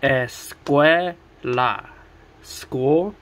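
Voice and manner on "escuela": Escuela broken into ES, CUE, LA; split into syllables. Shown as a demonstration. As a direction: say Escuela slowly, one syllable at a time. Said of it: "Three syllables".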